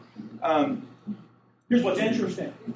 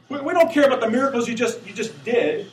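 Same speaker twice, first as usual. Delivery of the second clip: higher, quick-fire protest; shouted complaint; 9 semitones higher, 4 dB louder than usual. Acted. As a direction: brush it aside in a hurried complaint. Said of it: "they're shouting at God".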